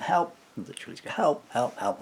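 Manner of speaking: loud, high cries of help; screaming for help. Retold or imitated. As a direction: call out for rescue across a waiting room. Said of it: "I just started screaming for help".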